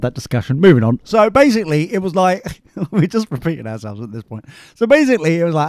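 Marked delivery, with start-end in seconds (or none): none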